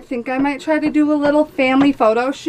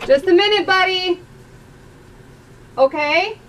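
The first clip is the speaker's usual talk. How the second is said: calling out; 7 semitones higher than usual. Real, not acted. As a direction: call out to the next room. Calling to son in shower.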